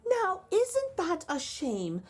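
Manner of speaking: butter him up sweetly; a giant's wife all honeyed charm; sweet-talking wife. A narrator's reading. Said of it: "with her sweetest smile".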